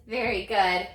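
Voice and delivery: ordinary delivery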